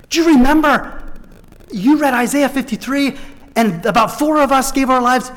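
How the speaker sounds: bursting to be remembered; a man running up in the street, eager and excited; excited former prisoner. Retold or imitated. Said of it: "a guy came running up to him".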